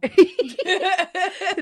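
high, loud burst of laughter. Real, not acted. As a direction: crack up laughing. Laughing at joke.

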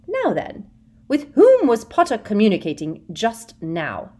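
high, loud eager encouragement; a sugary predator; Umbridge voice. A narrator's reading. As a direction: Umbridge urging Hermione. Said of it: "Umbridge interrogating".

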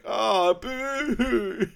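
a high, hammed-up boo; childish mock jeer; mock sulky boo. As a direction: jeer it like a sulking Austin. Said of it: "Austin's really pissed off. He's like".

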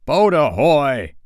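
high, loud, stretched hail; pirate captain's long sea call; Hook's repeated cry. A narrator's reading. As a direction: holler slowly across the water. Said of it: "again came the cry".

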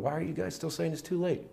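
gently lament, soft and pleading; mild tearful plea. Acted. Jesus crying over people saying too late, mild tearful plea. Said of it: "I wonder if Jesus isn't crying".

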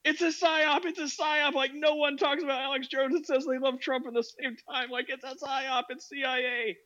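high, excited conspiracy rant; conspiracy theorist voice. Retold or imitated. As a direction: play a wide-eyed conspiracy nut. Mocking a conspiracy believer.

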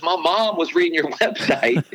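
imitating a coach's Southern twang, pitched well up; high, twangy Southern accent in a playful retelling. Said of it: "he's got the twang going".

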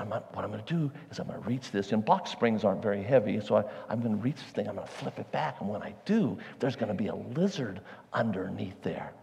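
soft, low-voiced plan; hushed voice. Father explaining the plan at the box spring, hushed and soft. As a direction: keep your voice down near the lizard.

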